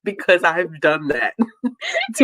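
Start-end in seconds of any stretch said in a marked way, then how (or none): 1.4-2.1 a high squealing laugh; high burst of laughter. Real, not acted. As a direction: laugh hard at yourself. Laughing at own story.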